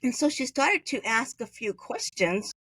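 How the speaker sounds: ordinary talk, nothing marked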